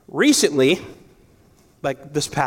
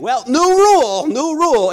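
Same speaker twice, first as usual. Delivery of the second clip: mock sore-loser father-in-law; 10 semitones higher, 6 dB louder than usual. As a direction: ham up a cheating dad changing the rules. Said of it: "and he'd be losing".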